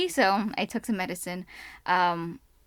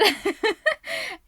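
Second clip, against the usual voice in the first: high burst of laughter; 12 semitones higher than usual. Real, not acted. Laughs after retelling call.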